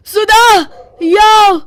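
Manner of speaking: high, loud call across the house; angry yell. Acted. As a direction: shout for his wife in fury. Husband yells for wife. (गुस्से में ज़ोर से पुकारना)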